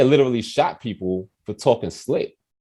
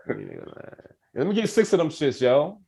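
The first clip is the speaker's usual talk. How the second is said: higher, swaggering baller voice; imitating young self; 6 semitones higher, slower than usual. Teen self ordering at the gas station, higher swagger. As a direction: act like you're really balling.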